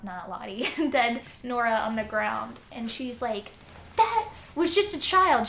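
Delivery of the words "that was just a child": high, loud imitated outcry; outraged protest. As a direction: voice a woman's indignant protest. Woman confronts soldiers over killing.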